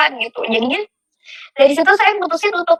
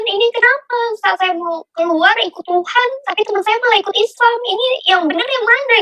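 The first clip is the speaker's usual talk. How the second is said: high, distressed plea to God; retold tearful questioning; 8 semitones higher than usual. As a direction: re-enact a bewildered, tearful prayer without crying. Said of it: "Itu aku nangis".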